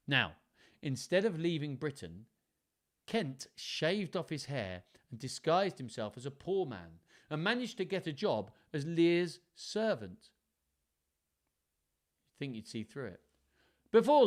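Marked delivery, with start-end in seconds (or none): none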